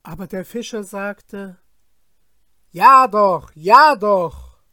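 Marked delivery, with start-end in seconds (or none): 2.7-4.6 loud mocking retort. Fisherman mocks the genie's cry.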